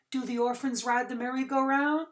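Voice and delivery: put-on high, hopeful child's question; boy asking Doctor Korchak, high child's voice; curious boy. A narrator's reading. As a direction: ask it wide-eyed, like a small boy.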